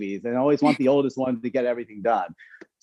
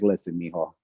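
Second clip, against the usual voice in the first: gentle mom; 6 semitones lower, 5 dB quieter than usual. Retold or imitated. Mom voiced pulling him aside.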